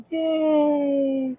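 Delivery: a high, thin squeal of a hooray; mock cheer. Told he has no cancer, a high mock cheer.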